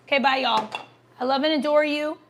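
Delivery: normal talking voice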